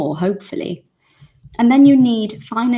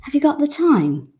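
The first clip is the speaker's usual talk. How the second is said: higher, casual native way to ask the time; native phrasing demo; 8 semitones higher than usual. Shown as a demonstration. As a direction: ask it the way a local would.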